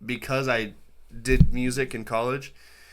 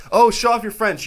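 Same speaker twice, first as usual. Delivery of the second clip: high, loud egging-on voice; mock eager request; 10 semitones higher, 9 dB louder than usual. Onlookers egging someone on to speak French, mock eager. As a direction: put on an excited, pushy onlooker. Acting out people demanding French.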